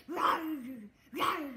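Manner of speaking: loud playful roar; lion roar. Shown as a demonstration.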